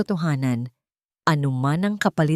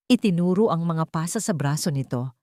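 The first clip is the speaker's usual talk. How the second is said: plain reading voice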